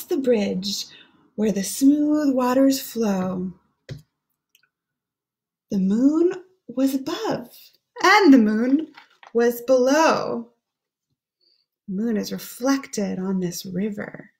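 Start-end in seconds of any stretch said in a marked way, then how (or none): none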